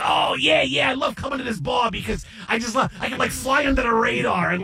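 lightly voiced rock star chatting. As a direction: gush like a laid-back rocker. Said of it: "he goes".

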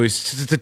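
long rapid stammer after "he's"; imitated stutter. Host shows how the bartender got stuck on a word, mock stuttering. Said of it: "stuck up on a word for a while like that".

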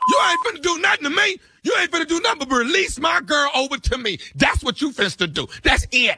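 mock angry shout. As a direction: fire it back like a tough inmate. Prank inmate escalates.

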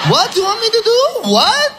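stoned guy refusing. Acted. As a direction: play the baffled stoner who won't budge.

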